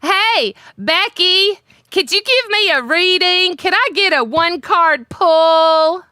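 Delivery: put-on high, loud Becky-style accent. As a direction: lay on a high-pitched mock Becky accent. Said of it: "laughing too much at your accent".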